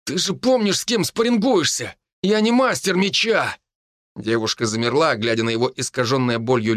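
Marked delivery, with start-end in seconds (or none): 0.0-3.6 pained rebuke. A narrator's reading. Roman hurt, rebuking Mila.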